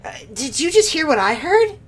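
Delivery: high, teasing octopus villain; sly villain voice. Acted. Villain taunting the inspector, high and sly. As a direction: taunt them from above. Streamer voicing Ultros villain.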